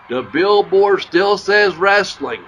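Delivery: mock yell. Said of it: "Murdoch yells out".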